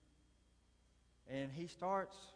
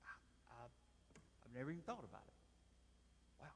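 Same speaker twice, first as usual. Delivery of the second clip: stunned reply; 12 dB quieter, much faster than usual. Retold or imitated. Stunned coworker's reply, voiced.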